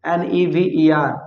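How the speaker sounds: slow letter-by-letter spelling. Shown as a demonstration. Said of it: "never". (धीरे-धीरे अक्षर-अक्षर spelling)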